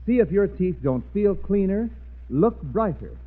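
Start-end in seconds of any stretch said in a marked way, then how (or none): none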